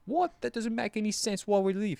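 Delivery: high, disbelieving mock protest; incredulous fiance. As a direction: act the confused fiance who won't leave. Fiance objecting in retold story.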